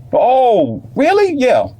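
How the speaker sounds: very high "really?"; hammed-up amazed reaction; mock surprise.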